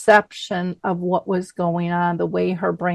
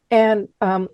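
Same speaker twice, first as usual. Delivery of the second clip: usual speaking voice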